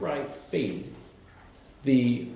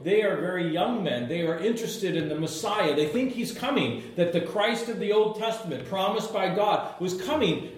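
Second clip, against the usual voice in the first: ordinary talk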